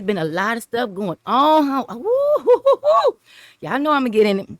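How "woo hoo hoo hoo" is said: high, whooping laugh at the news. Real, not acted. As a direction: whoop it up with a cackle. Gleeful laugh at the drama.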